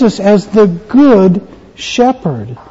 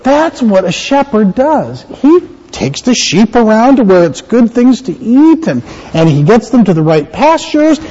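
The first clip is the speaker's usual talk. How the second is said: ordinary talk, nothing marked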